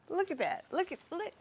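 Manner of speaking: high, excited repeated bursts; giddy excitement. Real, not acted. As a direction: build to a loud, high exclamation. Excitedly showing off the harvest.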